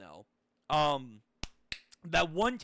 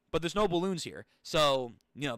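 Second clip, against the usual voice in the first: normal talking voice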